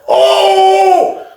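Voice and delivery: high, loud gasping oh; shocked oh. Retold or imitated. Hearing Sean exposed, a high shocked cry. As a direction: hit it like a sudden shock.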